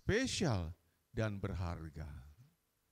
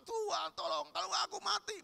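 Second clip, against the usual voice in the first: high, crying shout for help; mock desperate plea of a frightened believer; panicked cry. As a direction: beg God in a wailing panic. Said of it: "banyak orang teriak-teriak".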